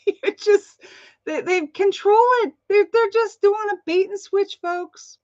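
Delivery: exasperated laughing rant. Real, not acted. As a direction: laugh it out in disbelief.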